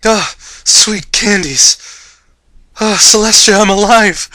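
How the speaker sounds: high, loud, slow joyful cry; ecstatic relief. Acted. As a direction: savor every word like candy. Character revels in being alive.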